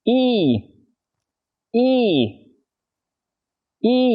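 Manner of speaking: Y drawn out high and tense; a tight English ee with the lips spread; model sound. Shown as a demonstration. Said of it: "quan sát miệng thầy nha".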